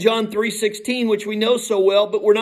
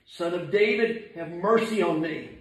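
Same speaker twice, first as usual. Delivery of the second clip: subdued plea; 5 dB quieter than usual. Acted. Believer praying as the lost man did, subdued plea. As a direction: plead humbly, letting it fall softly.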